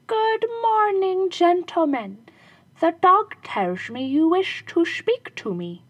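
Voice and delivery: very high, slow, loud shoemaker; smug shoemaker. Acted. Shoemaker greeting the robbers he is tricking, sly. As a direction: greet them with sly innocence.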